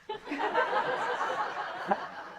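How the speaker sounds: high breathy laugh; amused high laugh at own story. Real, not acted.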